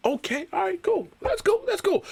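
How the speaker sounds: tiny high falsetto, softly mocking; soft, high-pitched mock nonchalance; sarcastic acceptance. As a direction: squeak out fake agreement. Sarcastic acceptance of rival roster.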